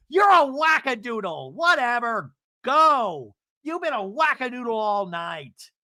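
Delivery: loud, high mock insult; mock angry taunt. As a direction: hurl it out like a reality-show quarrel. Acting out Emily's insult.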